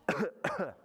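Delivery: short fake double cough; pretend cough. Storyteller shows children how sick Bear coughs, pretend cough. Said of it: "Sometimes he coughs".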